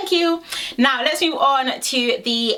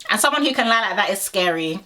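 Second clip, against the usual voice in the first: ordinary talk, nothing marked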